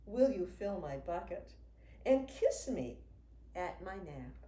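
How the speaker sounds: ordinary delivery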